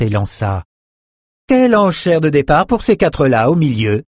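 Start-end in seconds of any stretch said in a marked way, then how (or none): 1.5-4.0 a higher, lightly voiced noblewoman; lightly voiced lady bidding; Elsa's voice. A narrator's reading. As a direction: ask it brightly as a bidder. Elsa voiced asking for a price.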